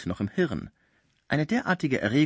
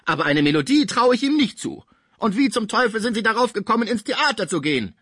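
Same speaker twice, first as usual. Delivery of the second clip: much higher, loud and indignant; furious old musician; 10 semitones higher, 5 dB louder than usual. A narrator's reading. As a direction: rage at the rival from the sickbed. Pons voiced in a rage. (Pons empört, hoch und laut)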